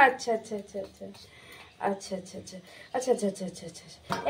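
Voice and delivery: soothing murmur. Real, not acted. Soothing the child.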